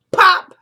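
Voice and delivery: sudden loud, high "pop"; imitated impact pop. Imitating the shovel hitting his head, loud pop. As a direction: punch out the noise like a blow.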